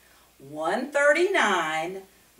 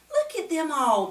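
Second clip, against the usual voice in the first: exclaiming; 8 semitones higher than usual. A narrator's reading. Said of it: "Punchinello exclaimed".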